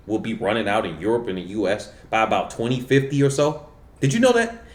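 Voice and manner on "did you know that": high, pointed did you know that; emphatic question. Real, not acted. To his viewers, a high emphatic question. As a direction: jolt the viewers with it.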